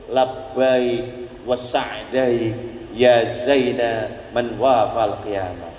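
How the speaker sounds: low, slow, odd creature voice, fading away; drawn-out lizard chant. Retold or imitated. The lizard answers the Prophet in a low, slow creature voice.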